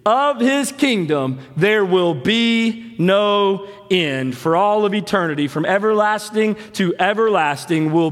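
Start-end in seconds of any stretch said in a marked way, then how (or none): 0.1-4.4 slow and deliberate, each word weighed; slow, weighty reading. Shown as a demonstration. Pastor to congregation, slow and weighty. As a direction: draw out the promise slowly. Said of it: "And listen to his kingdom".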